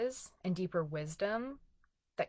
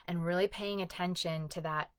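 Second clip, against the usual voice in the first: ordinary talk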